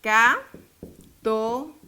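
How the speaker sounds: "Católico" as "Ca ... tó ... li ... co", very slow; syllable by syllable. Shown as a demonstration. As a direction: pause after every syllable of "Católico". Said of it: "dividámosla". ("Ca ... tó ... li ... co", sílaba por sílaba)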